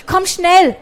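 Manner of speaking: high, loud call across the shop. A narrator's reading. Margarete calling her partner for help, loud call. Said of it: "rief Margarete".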